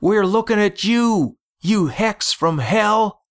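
very high-pitched yell, held back in volume. A narrator's reading. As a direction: call out defiantly from a safe distance, high. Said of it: "some brave soul yelled meanwhile".

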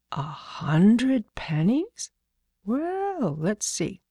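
very slow, questioning echo; musing, indulgent man. Acted. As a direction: draw it out as if weighing a child's big claim. Man muses on child's claim.

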